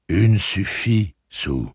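slow, drawn-out fatherly voice; measured, stretched-out words; father's inner voice. A narrator's reading. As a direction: speak slowly, like a father's voice echoing in her head. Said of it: "la voix de son père résonnant dans sa tête".

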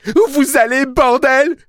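very high, loud outburst; angry yell. A narrator's reading. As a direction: yell like an enraged boy. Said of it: "hurla le garçon".

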